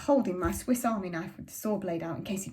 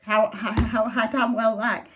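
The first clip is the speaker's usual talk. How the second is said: louder, slow, defiant father shouting; furious father; 6 dB louder, slower than usual. A narrator's reading. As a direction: spit it out, drawn out and loud. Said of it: "And Father shouted".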